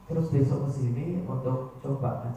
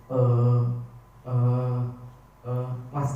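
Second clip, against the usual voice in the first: hesitant filler; 5 dB louder than usual. Shown as a demonstration. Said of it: "jeda "e" di situ".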